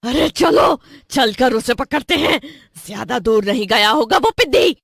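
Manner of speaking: rally your partner to catch the runaway; forceful storybook villainess; urgent witch. Acted. Witch urges chase of runaway.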